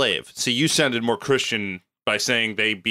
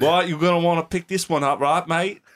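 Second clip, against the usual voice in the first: put-on Cockney accent; 4 semitones higher than usual.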